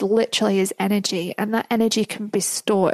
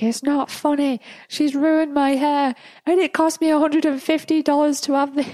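upset; 6 semitones higher than usual. Retold or imitated. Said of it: "He was so upset".

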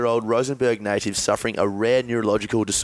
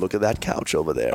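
light impression, a touch lower; Morgan Freeman; 5 semitones lower than usual. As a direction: lightly imitate a famous American narrator. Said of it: "do you know the great Morgan Freeman impersonation".

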